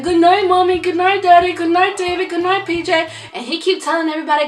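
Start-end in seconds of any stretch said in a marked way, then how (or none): 0.0-3.1 put-on higher, sing-song little boy voice; a small child saying good night to everyone; toddler's good nights. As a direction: chirp it like a sleepy toddler. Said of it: "He said".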